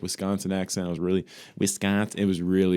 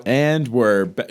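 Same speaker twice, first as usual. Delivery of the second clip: loud, drawn-out show comeback intro; mock announcer; 3 semitones higher, 5 dB louder, much slower than usual. Host jokes they are restarting from the top, mock announcer. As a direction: stretch it out like a host returning.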